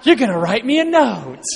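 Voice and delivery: loud, high disbelieving repeat; incredulous anger. Retold or imitated. Father repeating the doctor's offer in loud disbelief. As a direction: throw the doctor's words back loud.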